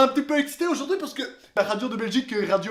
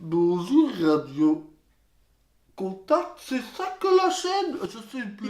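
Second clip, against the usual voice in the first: low, halting mock radio greeting; hammed-up clueless presenter searching for the station name; groping for words; 3 semitones lower, slower than usual. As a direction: imitate a lost host, long gaps between words. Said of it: "tu cherches toujours un peu tes mots".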